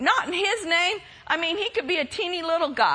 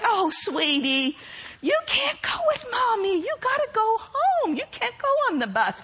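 put-on sweet baby talk; 6 semitones higher than usual. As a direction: coo at the puppy like a pet mom. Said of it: "she's like".

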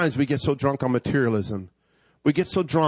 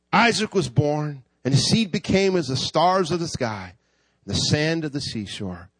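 ordinary talk, nothing marked

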